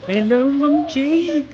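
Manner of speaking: high, slow sing-song greeting. Real, not acted. Greeting dog in baby talk.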